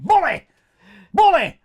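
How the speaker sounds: taunting a rude waiter each time he passes, shouting loud and high; shouted taunt. Retold or imitated. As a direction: yell it at him across the room. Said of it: "Like that".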